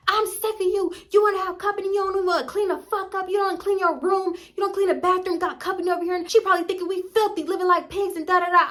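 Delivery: high-pitched rant, not full volume; mock yelling. Mom yelling at daughter about cleaning, high ranting. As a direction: imitate a mother yelling on and on. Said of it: "so she yelling".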